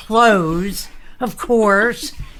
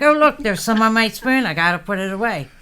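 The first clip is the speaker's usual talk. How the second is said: fast; 3 semitones higher, faster than usual. Retold or imitated. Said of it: "I'm like".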